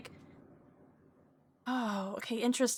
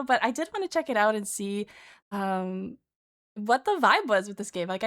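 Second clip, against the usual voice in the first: ordinary talk, nothing marked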